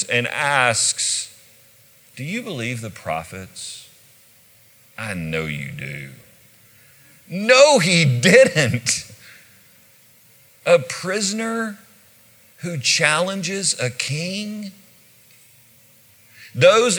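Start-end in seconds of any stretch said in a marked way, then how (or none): 2.2-6.1 quiet courage. Acted. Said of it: "with unspeakable courage". 7.3-9.0 high, loud, hammed-up scandalized reaction; mock-gasp it, as if utterly scandalized; shocked disbelief. Onlookers hear a prisoner challenge a king, acted loud shock. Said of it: "let out an audible gasp". 10.7-14.7 high, drawn-out disbelief; incredulous question. Acted. Dignitaries watch Paul challenge the king, acted high incredulity. Acting the stunned onlookers' reaction.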